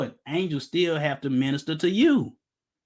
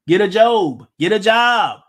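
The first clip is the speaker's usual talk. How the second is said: 1 shouted get a job; 3 semitones higher, 8 dB louder than usual. Real, not acted. Preacher barking a punchline.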